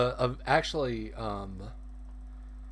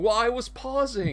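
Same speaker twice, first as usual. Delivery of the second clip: much higher, offended excuse; huffy villain; 10 semitones higher than usual. Retold or imitated. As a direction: whine it like a pompous baddie. Villain defends his speech.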